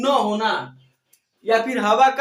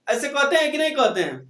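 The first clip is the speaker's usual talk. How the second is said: ordinary talk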